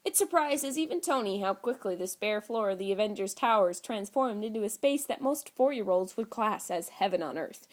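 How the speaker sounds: ordinary delivery, nothing marked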